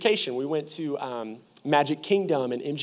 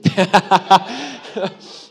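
a big, loud burst of laughter; hearty laugh; 2 semitones higher, 6 dB louder than usual. Real, not acted. Preacher laughing at his own story, loud laugh.

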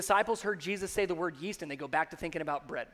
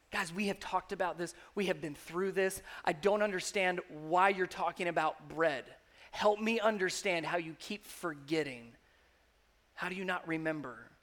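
gentle Jesus; 4 semitones lower, 5 dB quieter than usual. Acted. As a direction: lower and much softer, patient. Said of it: "the feeling that Jesus is having".

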